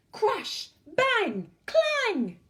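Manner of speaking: each word high and loud, hammed up; a suit of armour tumbling down the stairs; loud crash sounds. A narrator's reading. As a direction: bang out the crashes. Armour falling downstairs.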